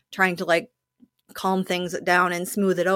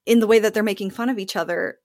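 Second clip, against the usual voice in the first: ordinary talk, nothing marked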